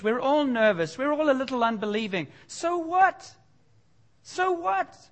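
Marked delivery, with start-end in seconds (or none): 2.5-5.0 mock shrug. Real, not acted. Dismissive retort, much higher.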